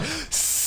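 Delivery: long soft sibilant hiss; jealous girlfriend's hiss, imitated; snake-like hiss. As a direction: imitate an angry warning hiss. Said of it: "but she fucking hissed".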